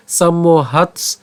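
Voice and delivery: সম্মোহাৎস slow, ending in a "ts" cluster; alternative pronunciation demo. Shown as a demonstration. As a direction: stretch সম্মোহাৎস and close on "hats". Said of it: "খণ্ডতর সাথে আমরা দন্তাস্বকে নিয়ে আসতে পারি".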